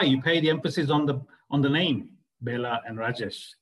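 ordinary delivery

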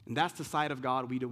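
ordinary talk, nothing marked

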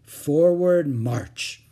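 loud, very drawn-out command; weary marching order. A narrator's reading. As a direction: call the parade on, stretching each word. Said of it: "said Mother warily".

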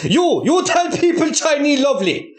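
mock Chinese accent. Host orders hostage to praise, mock Chinese accent. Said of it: "these fucking voices are more".

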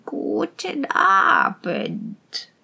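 very slow, drawn-out witch's rasp. A narrator's reading. Old witch greeting the strangers by the fire, rasping. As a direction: rasp out a creepy, lingering welcome. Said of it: "schnarrte sie".

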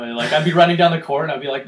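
ordinary delivery

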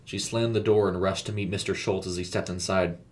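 plain reading voice

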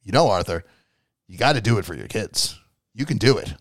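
low, subdued pep talk; mock-solemn guardian angel, voice dropped way down; Matthew Lillard. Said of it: "cleaning his glasses and he goes".

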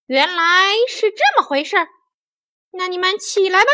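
high-pitched monkey voice, easing off; Monkey King. A narrator's reading. Monkey King accepting the fairies' explanation, high-voiced.

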